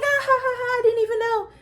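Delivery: amused high voice of the friend spotting her sign; imitating friend laughing. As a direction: mimic her giggling surprise. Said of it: "And she was like".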